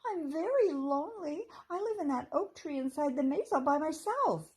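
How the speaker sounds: high, loud squeaky squirrel; squeak. A narrator's reading. Fluffy accepting the invitation to stay, eager high squeak.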